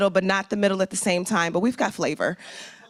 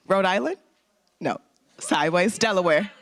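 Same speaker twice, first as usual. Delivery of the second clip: usual speaking voice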